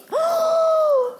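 sharp high gasp before breathing fire. Acted. Dragon gathers courage, gasping in a huge breath. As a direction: suck in a big breath, loud.

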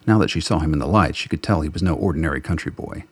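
normal talking voice

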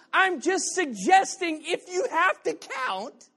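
high, slow, half-laughing; mock-earnest preaching. Real, not acted. Preacher teasing the congregation, hammed up. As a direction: lay it on thick with a grin. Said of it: "Why am I talking like this?".

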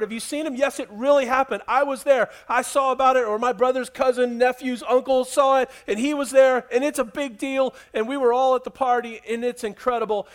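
high, loud, breathless rush of news; a townsman spilling the news, thrilled; excited gossip. Acted. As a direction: tumble the story out eagerly. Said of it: "People were excited about this".

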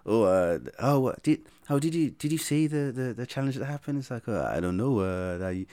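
put-on light French accent in the manner of a football manager, hesitant and halting; an Arsene Wenger voice in a post-match interview, full of filler sounds; Arsene Wenger impression. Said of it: "I tend to pick up accents from managers".

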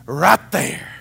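loud, high panicked report; freaking out. Retold or imitated. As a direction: yell it as if spooked. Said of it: "she's freaking out".

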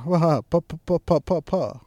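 spoken "pa pa pa" gunfire noises; mock machine gun. Acting out the villain's machine gun, spoken gunfire. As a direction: rattle off fake machine-gun sounds. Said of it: "machine gun".

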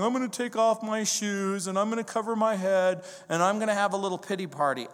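mock David sulking, then rush the last line; a lightly voiced sulky David, speeding up at the end; mock pity party. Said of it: "This isn't David going, pity party".

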